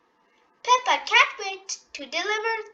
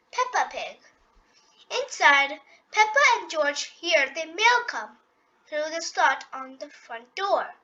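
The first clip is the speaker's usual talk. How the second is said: ordinary delivery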